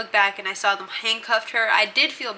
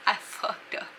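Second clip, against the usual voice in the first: breathy laugh into the words, slow; rueful laugh; 6 dB quieter than usual. Real, not acted. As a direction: laugh at yourself while admitting it. Laughing at own mistake.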